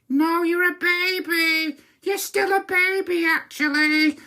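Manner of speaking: very high, drawn-out singsong jeer; childish taunt. Retold or imitated. Brother answering his sister the CEO, childish high taunt. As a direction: taunt her like a kid in the playground.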